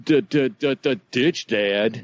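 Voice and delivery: high, quick sing-song ditching; mock teen daughter. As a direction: toss it off like a bored teen.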